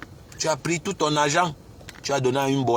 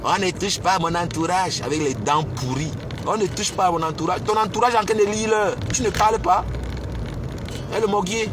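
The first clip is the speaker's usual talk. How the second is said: higher, raised tirade; angry rant; 5 semitones higher than usual. Real, not acted. Ranting at the man on her live, furious and raised. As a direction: tear into him, defending your people.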